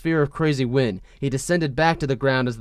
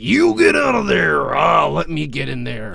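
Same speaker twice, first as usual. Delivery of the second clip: wrestler shout; 3 semitones higher, 4 dB louder than usual. Retold or imitated. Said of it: "like a WWE wrestling match".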